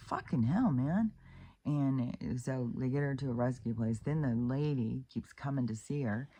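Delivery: ordinary talk, nothing marked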